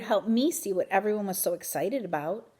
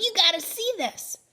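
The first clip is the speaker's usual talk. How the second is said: excited; 12 semitones higher than usual. A narrator's reading. Thomas calling friends over.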